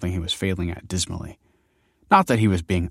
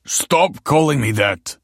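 slow, loud, higher-pitched outburst; agitated old man's slow shout; angry old man; 7 semitones higher, 5 dB louder, slower than usual. A narrator's reading. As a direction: lash out as a confused old man. Old man throws bowl, protests.

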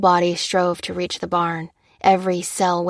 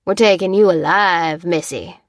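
menacing taunt; 6 dB louder than usual. A narrator's reading. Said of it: "cried the leader".

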